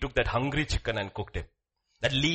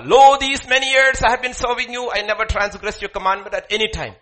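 high, loud resentful protest; resentful complaint; 9 semitones higher, 11 dB louder than usual. Acted. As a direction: complain hotly to father. Voicing resentful elder son.

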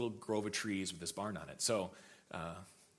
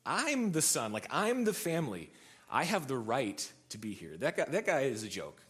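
louder, higher, emphatic claim; retold indignant assertion; 3 semitones higher, 4 dB louder than usual. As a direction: insist on your right, indignantly. Acted indignant claim of family right.

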